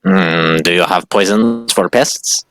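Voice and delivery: stilted creature voice with rising questions; probing rat-creature. Acted. Creature asking merchant about poisons, halting and probing. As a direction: fish carefully for something stronger.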